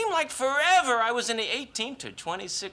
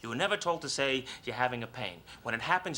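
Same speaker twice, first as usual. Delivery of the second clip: usual speaking voice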